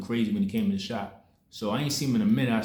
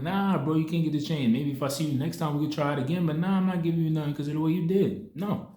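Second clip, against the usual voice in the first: firm refusal; 6 semitones higher than usual. Retold or imitated. Refusing the pushy guy.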